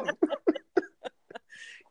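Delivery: a high, breathy burst of laughter. Real, not acted. Guest laughing because he knew the topic, high laugh.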